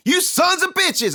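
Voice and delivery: high, loud and slow furious yell; angry shout. Acted. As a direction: yell it at the pranksters. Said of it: "I cried".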